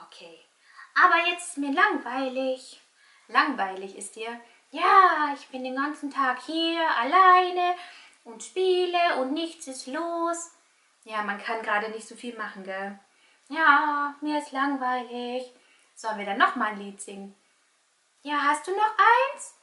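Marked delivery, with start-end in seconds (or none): 0.9-10.5 whiny little puppet voice; bored puppet. Pretend. 13.5-19.5 bored little puppet. Pretend. Puppet talks to host.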